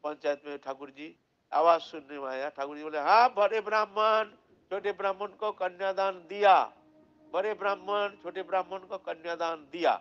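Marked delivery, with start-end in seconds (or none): 3.0-6.6 louder booming declaration, stretched out; loud proclamation. Acted. The deity's voice declaring to the village council, loud and proclaiming. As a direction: announce it to the whole assembly.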